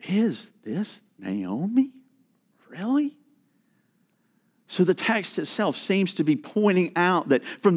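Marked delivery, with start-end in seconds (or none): 0.1-3.2 disbelieving what they see; incredulous. Acted. Townswomen doubting it is Naomi, incredulous and soft. Women doubting her changed appearance.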